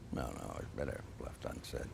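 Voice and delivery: deep, hushed, coy withholding; low, sly grand old director; Orson Welles voice. Retold or imitated. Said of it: "In this deep, rich voice".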